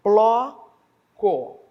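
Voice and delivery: loud, drawn-out syllables with gaps. Shown as a demonstration. Teacher splitting a word for pupils, syllable by syllable. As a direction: break the word apart, each syllable held.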